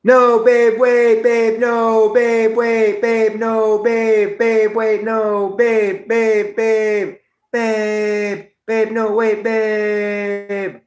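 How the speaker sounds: high, pleading falsetto, fading to a thin whine; pleading Hot Rod quote. Retold or imitated. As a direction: beg desperately in a squeaky high voice. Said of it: "The three best parts of Hot Rod are".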